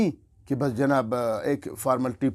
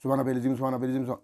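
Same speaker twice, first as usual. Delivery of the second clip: "سبحان ربی العظیم" gabbled fast, swallowed; mock hurried mumbling; rushed tasbih; much faster than usual. Shown as a demonstration. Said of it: "اس طرح نہیں پڑھو بھائی". (جلدی جلدی بے دھیانی کی تسبیح)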